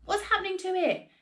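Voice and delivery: alarmed cry. A narrator's reading. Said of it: "cried Mister Killycranky".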